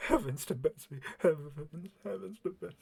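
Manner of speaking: hushed, repeated muttering, fading; soft, fading self-soothing whisper; whispering to himself. Acted. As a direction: murmur it to yourself in shock. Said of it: "just kind of whispering to him to himself".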